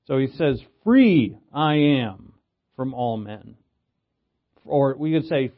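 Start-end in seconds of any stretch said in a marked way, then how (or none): 0.8-3.6 Free drawn out loud, then dropping away; stress on Free. Shown as a demonstration. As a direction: punch "Free" slowly at the front. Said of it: "He's emphasizing freedom. He puts free first".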